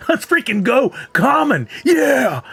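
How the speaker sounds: excited shout. Acted. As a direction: cheer like you hit the jackpot. Hyped reaction to pulling common.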